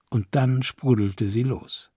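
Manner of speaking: usual speaking voice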